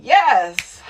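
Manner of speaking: ordinary talk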